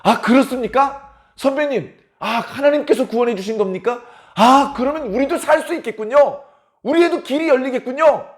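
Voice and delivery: high, excited exclamations of relief; acted rejoicing. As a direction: react with eager, hopeful delight. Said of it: "이렇게 기뻐하는 겁니다".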